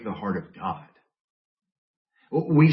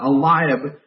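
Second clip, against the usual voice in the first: ordinary talk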